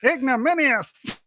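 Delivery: put-on American accent. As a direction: stretch out "Ignominious" in an American accent. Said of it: "say it like an American".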